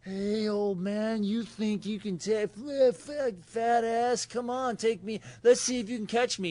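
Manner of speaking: hammed-up taunting teen, high pitched; taunting the old man. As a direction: bait your father, teasing. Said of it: "I'm like".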